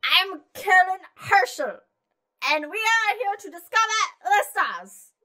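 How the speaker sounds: high, loud mock-German proclamation; yelled German accent. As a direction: shout it grandly in a German accent. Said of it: "Just yell it".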